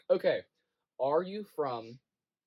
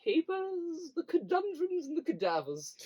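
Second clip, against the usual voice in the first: fancy voice; 8 semitones higher than usual. Pretend. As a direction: savour each fancy word, pitched high. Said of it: "All fancy people have a fancy voice".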